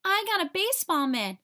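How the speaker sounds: put-on mild boyish shout; eager shout. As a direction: call out to be heard. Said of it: "shouted George".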